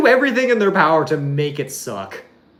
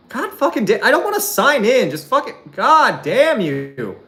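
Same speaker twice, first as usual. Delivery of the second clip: higher, exasperated swearing at the screen; frustrated cursing; 7 semitones higher, 3 dB louder than usual. Real, not acted. Streamer can't find the clip, fed-up cursing. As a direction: curse the website in real annoyance. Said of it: "frustrated at failed video search".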